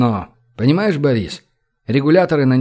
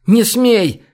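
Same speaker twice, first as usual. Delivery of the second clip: high, loud shout of protest; crying out; 8 semitones higher, 5 dB louder than usual. A narrator's reading. Olga tries to stop Boris's order, crying out. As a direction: shout it to stop him, pitch leaping up. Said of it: "крикнула Ольга".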